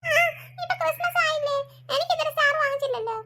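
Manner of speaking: child's whining mock cry; tearful complaint. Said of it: "ഈ കണ്ണീരൊഴുക്കി കരയുന്നത്". (കരഞ്ഞുകൊണ്ട് പരാതി പറയുന്നു)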